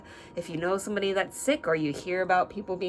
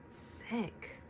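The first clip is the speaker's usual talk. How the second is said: whispered sick; 4 semitones higher, 8 dB quieter than usual. Acted. Refrain ending whispered.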